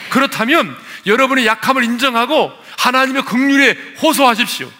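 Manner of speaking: high, loud pulpit exhortation; full-force preaching. Acted. Preacher urging congregation to appeal to God's mercy, fervent loud preaching. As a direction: build to a forceful ringing appeal.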